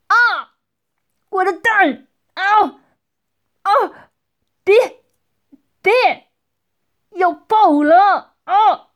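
high-pitched cries of agony; pained screaming. Acted. Gang boss under interrogation crying out in pain. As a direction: cry out high in short pained bursts.

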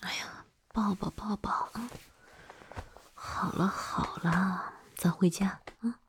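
soft, hushed comforting; tender soothing. Acted. Hugging a crying drunk partner to take them home, soothing. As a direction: soothe them gently and quietly.

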